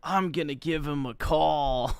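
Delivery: put-on drunk voice. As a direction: play a tipsy guy about to call. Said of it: "D S P was definitely drunk as fuck".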